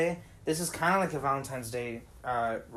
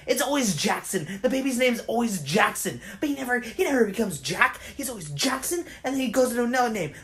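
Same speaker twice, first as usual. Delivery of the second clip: usual speaking voice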